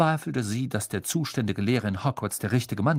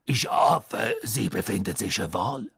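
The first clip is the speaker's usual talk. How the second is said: lowered larynx voice. A narrator's reading. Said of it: "mit tiefer Stimme".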